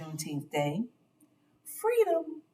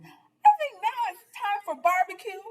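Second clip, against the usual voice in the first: put-on playful old lady; 12 semitones higher than usual. Winked and chuckled.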